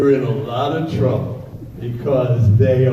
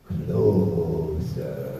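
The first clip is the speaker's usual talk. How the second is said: put-on much lower, eerie possessed child; creepy deep kid; 10 semitones lower, 6 dB quieter than usual. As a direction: summon him like a horror-film child.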